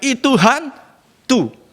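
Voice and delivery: high, very slow drawn-out cry of fear; frightened cry. Retold or imitated. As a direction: stretch out the scared cry. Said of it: "mereka teriak". (teriakan takut yang panjang dan tinggi)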